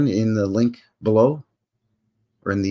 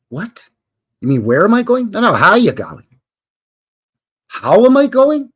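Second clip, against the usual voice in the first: confused Canadian; 9 semitones higher, 4 dB louder than usual. Acted. Acts confused Canadian in dialogue.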